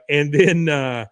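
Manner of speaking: ordinary talk, nothing marked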